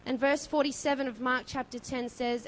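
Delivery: usual speaking voice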